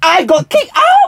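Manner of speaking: slow, high, laughing disbelief; mock shock. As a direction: stretch it out in fake shock.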